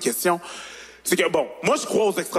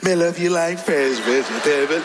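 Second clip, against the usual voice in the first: slow, lilting Jamaican accent; slower than usual. Shown as a demonstration. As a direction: croon it like a Jamaican charmer. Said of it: "si je prends mon accent jamaïcain".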